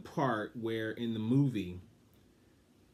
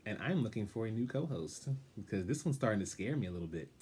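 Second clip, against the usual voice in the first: usual speaking voice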